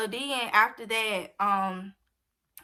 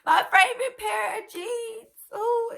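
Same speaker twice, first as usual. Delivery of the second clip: high, whiny tearful lament; playful near-sobbing wail; mock crying; 13 semitones higher, 6 dB louder than usual. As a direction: whimper over a beloved lost thing. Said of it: "that made me want to cry".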